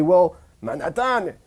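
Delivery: put-on frustrated French accent, rising in pitch and volume; exasperated French accent. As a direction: protest in a French accent, building to a high, loud outburst. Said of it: "the French person might say".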